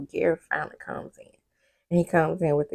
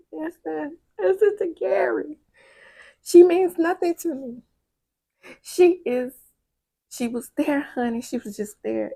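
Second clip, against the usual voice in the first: higher, pressing plea, lightly voiced; pleading man; 5 semitones higher than usual. Gary begging Peniel to hear him out, higher and pressing.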